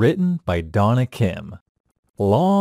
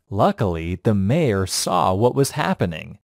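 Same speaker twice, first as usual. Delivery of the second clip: plain reading voice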